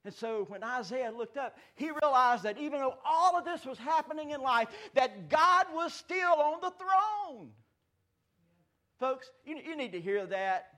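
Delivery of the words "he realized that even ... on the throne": high, loud and slowing; preaching at full force. Acted. Pastor proclaiming God's reign, loud and high. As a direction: swell it up to the throne.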